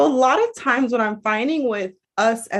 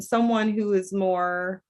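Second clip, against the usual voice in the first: normal talking voice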